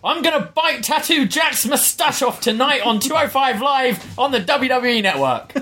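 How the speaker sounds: a put-on British wrestler bellow, kept at talking level; Oney Lorcan voice. Reading a wrestler's tweet aloud in his shouty voice. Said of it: "what even is that voice? Is that Oney Lorcan's voice?".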